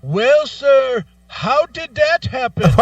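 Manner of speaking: high, slow, politely puzzled; mock baffled agent. Agent asking caller how it happened, high slow mock voice. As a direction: play the rep blind to the obvious.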